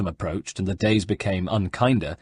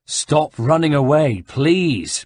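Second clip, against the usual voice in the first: louder, slow earnest plea; pleading; 5 semitones higher, 5 dB louder, much slower than usual. Acted. Ezio pleads with the fleeing abbot, slow and earnest. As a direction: beg him to stop. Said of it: "pleaded Ezio".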